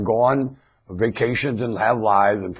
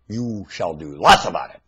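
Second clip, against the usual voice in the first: ordinary talk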